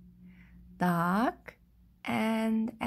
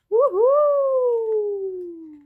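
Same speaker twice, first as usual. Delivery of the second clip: loud, high, long-held cheer; excited woohoo; 9 semitones higher, 10 dB louder than usual. Real, not acted. Storyteller cheering after saying goodbye, high loud whoop.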